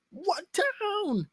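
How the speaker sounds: hammed-up high-pitched scream; imitated scream. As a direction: yell the demand like a desperate captor. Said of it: "He screamed like".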